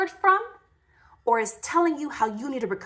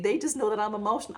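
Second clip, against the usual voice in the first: ordinary delivery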